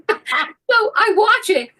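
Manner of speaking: normal talking voice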